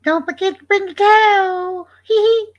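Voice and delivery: very high put-on voice with a little giggle; squeaky cartoon character; high cartoon catchphrase. Streamer doing a towel catchphrase for chat, high cartoon voice.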